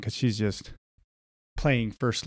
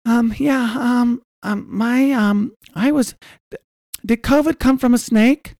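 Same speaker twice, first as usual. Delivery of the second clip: much higher put-on girly voice, hesitant; hammed-up high girl voice with nervous ums; girl caller; 13 semitones higher, 5 dB louder than usual. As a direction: play a timid young woman calling in. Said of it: "Do a girl".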